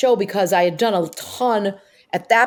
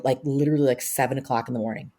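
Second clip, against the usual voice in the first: usual speaking voice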